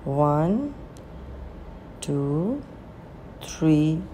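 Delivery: soft, spaced-out meditation counting; guided breathing count. Acted. Meditation guide leading listeners through inhale, hold and exhale, softly counted. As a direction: count the breaths gently, leaving room to breathe.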